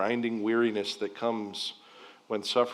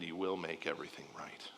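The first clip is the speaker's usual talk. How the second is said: whispered prayer; 5 semitones lower, 12 dB quieter than usual. Acted.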